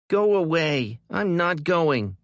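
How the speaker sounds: put-on high, loud defiant child; a little girl refusing; stubborn child yelling. A narrator's reading. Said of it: "Kylie yelled".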